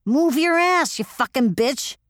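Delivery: sharp high yell, driving her on; a pilot's rough radio shout, pushing hard; retold shout. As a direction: yell at her to keep moving. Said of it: "she was shouting at me the whole time".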